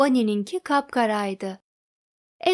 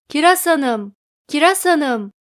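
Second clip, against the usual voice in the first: loud call, higher pitched; 3 semitones higher, 6 dB louder than usual. Acted. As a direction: shout for his wife across the yard. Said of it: "diye haykırdı Kiraz Bey".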